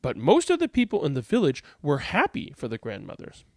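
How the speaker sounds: expressive read-aloud with lilting emphasis; picture-book narration. A narrator's reading. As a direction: read aloud to children, lively and measured.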